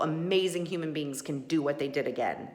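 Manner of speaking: ordinary talk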